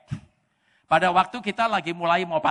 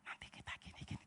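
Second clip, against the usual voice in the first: soft lovers' whisper; 22 dB quieter, faster than usual. Retold or imitated. Preacher acting a courting lover whispering to his sweetheart. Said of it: "Ngomong bisik-bisik".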